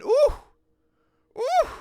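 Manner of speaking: high, loud rising ooh; excited ooh. Real, not acted. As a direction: coo at the near-perfect pitch. Pitch nearly perfect.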